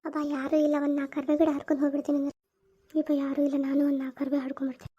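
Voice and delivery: sneaking leaves while nobody watches; sneaky hushed voice. Acted. Thief sneaking to the curry leaf plant, hushed and furtive.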